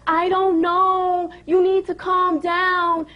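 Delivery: high, drawn-out mock reply; mocking imitation. Owner mimicking the sitter telling her to calm down, mocking drawl. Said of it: "She's like".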